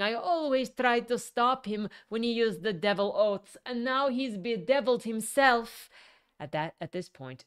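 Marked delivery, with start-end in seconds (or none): 0.0-5.9 loud high distraught yell. A narrator's reading. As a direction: yell it in tearful despair. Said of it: "grabbing at his suit and yelling".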